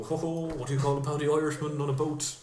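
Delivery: jolly mock Dublin accent; fake Dublin accent. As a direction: put on a stage Dublin accent. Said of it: "put on a fake Dublin accent".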